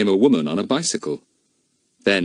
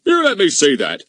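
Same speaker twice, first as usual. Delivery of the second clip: gruff policeman; 10 semitones higher, 5 dB louder than usual. A narrator's reading. Goon demands telegram.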